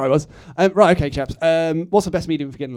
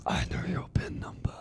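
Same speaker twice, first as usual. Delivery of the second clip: creepy whisper; 11 dB quieter than usual. Acted.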